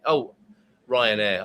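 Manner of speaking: usual speaking voice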